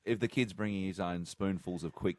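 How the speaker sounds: giggling through the words; cracking up mid-sentence; laughing while talking. Real, not acted. Mock breastfeeding image, laughing.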